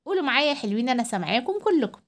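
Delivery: ordinary talk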